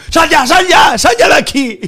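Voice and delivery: high, loud furious owner shout; retold angry bar owner. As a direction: order him out at full volume. Owner yells through the door.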